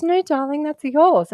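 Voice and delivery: a hint of the sonographer breaking the news; imitating sonographer. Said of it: "And she goes".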